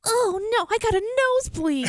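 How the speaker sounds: high, dismayed anime voice; anime character. Acted. Co-host parodies anime, high dismayed voice. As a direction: put on a hammed-up anime kid.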